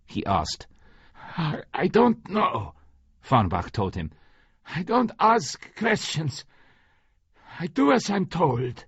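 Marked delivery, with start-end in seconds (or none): 1.4-8.8 higher, hesitant voice of a frightened underling; nervous underling. Acted. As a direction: stammer out the excuse. Farnbach's nervous excuse.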